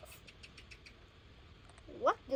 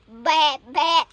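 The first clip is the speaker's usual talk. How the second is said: much louder playful baa; bleating sheep; 9 dB louder than usual. Pretend. Child reading a picture book, bleating for the sheep. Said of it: "Sheep say".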